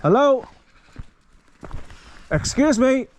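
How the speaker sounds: high, loud hail; calling out. Real, not acted. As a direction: get a stranger's attention from far off. Calling to a distant walker.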